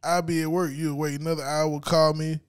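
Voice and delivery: normal talking voice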